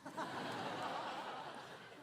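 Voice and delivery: bursting laugh; real laugh. Real, not acted. Speaker joking with audience, real laugh.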